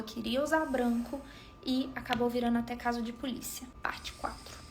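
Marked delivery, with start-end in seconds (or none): 3.8-4.6 whispered aside; unvoiced whisper. Real, not acted. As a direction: breathe the words out with no voice. Whispered part title.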